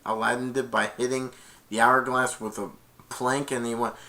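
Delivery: usual speaking voice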